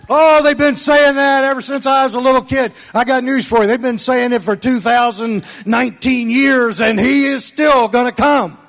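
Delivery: loud, high, emphatic; fired-up preaching. Real, not acted. Pastor preaching to his congregation, fired up. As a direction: preach it with conviction.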